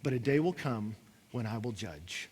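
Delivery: low, soft, grave divine voice; voice of God. Retold or imitated. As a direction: speak as God, quietly final. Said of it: "And God tells them".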